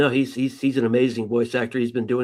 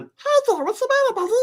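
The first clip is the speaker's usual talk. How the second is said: very high squeaky comforting voice; squeaky cartoon voice. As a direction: coo at a crying kid, cartoon-high. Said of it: "I do that voice on the subway".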